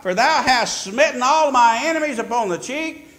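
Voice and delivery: loud, high proclaiming of the psalm; thundering scripture reading; preaching scripture. Acted. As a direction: call out the verses. Preaching the psalm verses aloud.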